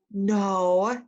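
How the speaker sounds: puppet gasps and answers; a sudden realization in a puppet voice. Acted.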